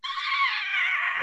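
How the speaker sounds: thin high strangled gasp. Retold or imitated. Winded girl answering her teacher, high gasp. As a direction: squeak out a breathless gasp. Can't breathe, gasping.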